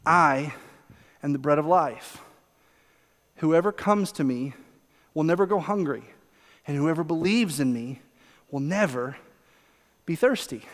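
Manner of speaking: soft, unhurried comfort; so gentle. Acted. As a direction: lay each phrase down tenderly, with long pauses. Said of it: "He just so gently says".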